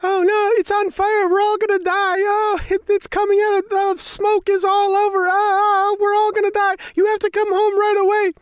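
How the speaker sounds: very high, loud, frantic wailing; hammed-up imitation of a hysterical wife; screaming panic. Wife telling husband the house is on fire, screaming. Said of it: "took a deep breath and go".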